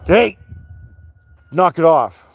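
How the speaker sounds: sharp, loud, high yell; shout. Real, not acted. Owner yelling at his dogs to stop fighting, loud. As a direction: bark it at the dogs.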